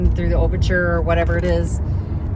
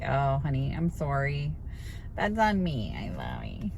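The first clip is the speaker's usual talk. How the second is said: softer, tender apology; apologetic; 4 semitones lower, 8 dB quieter than usual. Retold or imitated. To the stuttering cashier, soft tender apology. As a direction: comfort her, genuinely sorry. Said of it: "I'm like".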